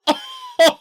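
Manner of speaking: a high, loud, hammed-up burst of laughter; mocking Superman laughing at a bad joke; mock hearty laugh. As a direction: imitate a big goofy laugh. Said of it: "he just starts laughing like".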